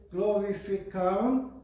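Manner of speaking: normal talking voice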